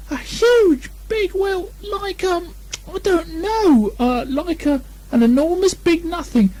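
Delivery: very high stammering squeaky voice; a scared little pig groping for words; high piping, hesitant and flustered; Piglet flustered. Acted. Piglet describing the monster.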